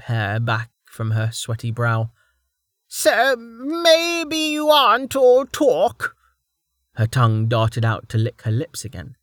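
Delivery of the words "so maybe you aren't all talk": high, slow, mocking crone voice; taunting old woman. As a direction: drawl it out like a cackling old lady.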